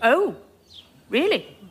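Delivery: normal talking voice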